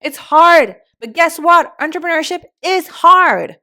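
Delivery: loud, high, impassioned rant; worked up, voice pushed high and loud; fired up. Real, not acted. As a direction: hammer it home with real heat. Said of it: "getting so fired up".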